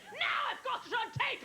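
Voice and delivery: high, laughing yell of an angry caller; a hammed-up furious caller; mock scream. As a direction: shout it high while cracking up. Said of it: "He calls me screaming".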